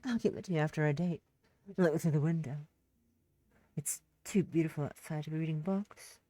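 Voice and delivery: a creep hitting on someone; low and smarmy, leaning in too close; sleazy flirt. Acted. Same man keeps pushing.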